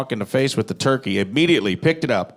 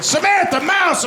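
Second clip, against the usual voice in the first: very high drawn-out shout of the name; high mock yell, mimicking her; mocking shriek; slower than usual. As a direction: screech it like the lady pirate did.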